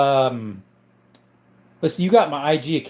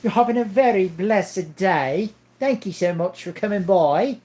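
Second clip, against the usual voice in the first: raised, affected character greeting; voice-acting character; 4 semitones higher than usual. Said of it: "would love to be a voice actor".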